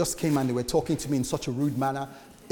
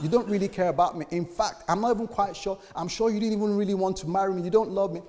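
a wife hurling her grievance, raised voice; upset wife; 7 semitones higher, faster than usual. Retold or imitated. Wife accusing the husband of not loving her, raised and high. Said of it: "This is the wife telling the husband".

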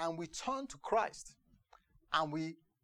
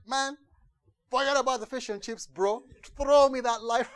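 desperate yell; 9 semitones higher, 12 dB louder than usual. Retold or imitated. Drowning man pleads for rescue.

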